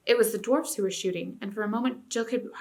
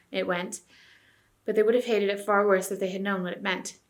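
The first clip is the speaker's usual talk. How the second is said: usual speaking voice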